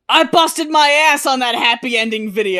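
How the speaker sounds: mock the guy's self-pity; mocking his response. Host mocks the singer's whiny reply, lightly hammed-up. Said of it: "His response".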